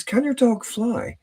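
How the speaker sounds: high, drawn-out kid voice, lightly acted; small child's question. Little kid repeating the question to a puzzled dog owner, childlike. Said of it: "the little kid says".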